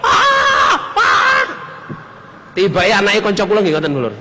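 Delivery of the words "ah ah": high, loud mock cry of a homesick boy; imitated yell. Preacher mimics the homesick boy yelling in the rice field, high mock cry. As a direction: yell out high and long like a child shouting in a field. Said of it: "ke sawah teriak-teriak".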